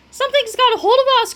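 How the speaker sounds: put-on high, loud kid's voice; alarmed cry. A narrator's reading. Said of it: "cried Cheeseball".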